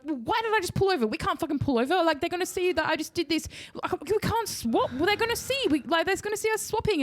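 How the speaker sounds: high, flustered, second-guessing himself; dad panicking after pulling over in view of cops, high and flustered. Retold or imitated. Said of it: "And then he's like".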